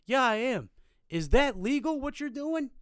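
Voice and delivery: mock officious shouting from a balcony; indignant calling out. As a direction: imitate a nosy neighbour hollering down. Neighbour challenging them from balcony.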